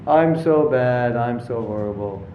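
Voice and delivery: hammed-up gloom; self-pitying. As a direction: drag it out like a mock mope. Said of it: "so I feel bad".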